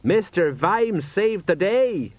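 high, drawn-out proud announcement; excited. A narrator's reading. Sergeant boasting of his commander, excitedly. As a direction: proclaim it with excitement. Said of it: "said Sergeant Colon excitedly".

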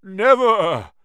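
strange, loud croaked spider voice; croaking spider. A narrator's reading. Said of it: "croaked the old spider".

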